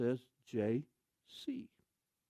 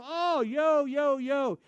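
high delighted Oh, yo, yo; greeting the pastor's little kids eagerly; high excited yo; 9 semitones higher, 3 dB louder, much slower than usual. Retold or imitated. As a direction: coo happily at the kids. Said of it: "I go".

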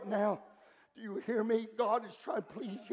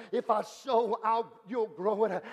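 ordinary talk, nothing marked

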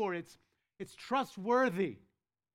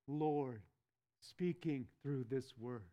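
hushed reverence; 6 semitones lower, 6 dB quieter, slower than usual. Acted.

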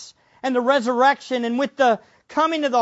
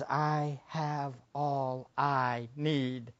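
very slow, soft and low; soft contentment; 7 semitones lower, 11 dB quieter, much slower than usual. Acted. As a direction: let it settle gently. David's contentment, softly.

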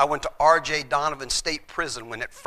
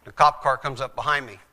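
normal talking voice

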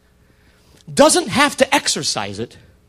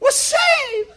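very high, slow Southern belle; swooning mock-Southern lady, vowels stretched out; southern accent; much slower than usual. Lady seeing the pursuers gone, relieved Southern falsetto. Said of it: "That's my southern accent".

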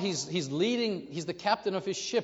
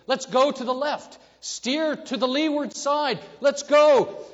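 much higher, louder, urgent cries; desperate high shout to steer left; frantic shouting; 10 semitones higher, 14 dB louder than usual. Retold or imitated. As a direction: yell like a man crazed by the siren. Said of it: "I'm going to say".

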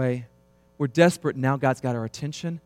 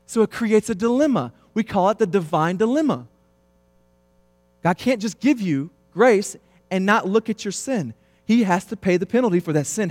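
louder, higher preaching voice; fiery preaching; 7 semitones higher, 4 dB louder than usual. Acted. Pastor pressing the congregation on sin, fiery preaching. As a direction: drive the point home to the pews.

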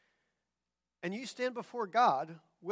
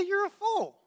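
high, loud rebuke; scornful judge; 11 semitones higher, 6 dB louder than usual. Acted. Judge answering a bad driver's excuses, loudly scornful. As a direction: pronounce it like an exasperated judge. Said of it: "What would the judge say".

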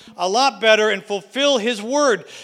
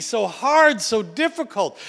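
ordinary delivery

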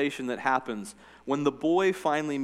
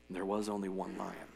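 lion's voice; 5 semitones lower, 10 dB quieter than usual. A narrator's reading. Aslan's voice reading Narnia.

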